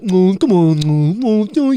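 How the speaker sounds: dopey doofus voice. Pretend. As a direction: play a slow-witted dude. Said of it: "my doofus voice".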